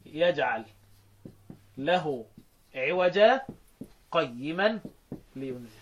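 slow word-by-word recitation with pauses; slow demonstration of a Quran verse. Shown as a demonstration. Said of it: "الآية بتقول".

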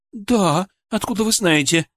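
high, surprised woman's voice; amazed wife. Acted. Wife amazed by doctor's question. (изумлённая жена, высоким голосом)

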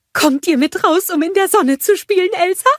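high, loud eager snowman; Olaf. A narrator's reading. Snowman inviting queen to play, high eager voice. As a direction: ask in an excited squeaky voice. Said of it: "fragte Olaf".

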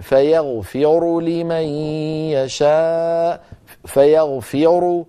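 slow recitation, full u on the heavy ر of فيغفر; فيغفر in slow chant, ending in a full heavy ru; heavy connected ra. Shown as a demonstration. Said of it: "راء مضمومة مفخمة".

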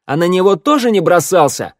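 loud, high-pitched taunting question; venomous. A narrator's reading. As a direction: needle him accusingly, loudly. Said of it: "ядовито выкрикнул Ворона". (ядовито, с вызовом)

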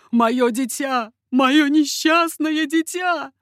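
loud, slow, rising wail; anguished cry. A narrator's reading. Old father lamenting his doomed child, crying out. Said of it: "вскрикнул старик".

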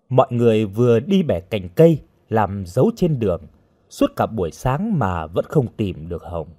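usual speaking voice

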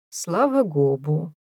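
slow, whiny stretch on Гобу; capricious drawl. Acted. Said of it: "с капризной растяжечкой".

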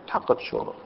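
whispered aside. Real, not acted. Teacher answers own question.

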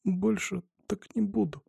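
put-on slow, faint, gasping child; breathless whisper. A narrator's reading. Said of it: "задыхаясь, прошептал Саша".

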